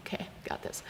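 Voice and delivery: whispered, nervous pep talk; whispered resolve. Retold or imitated. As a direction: whisper it to brace yourself. Steeling herself to restart movie.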